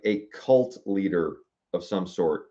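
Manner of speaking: ordinary delivery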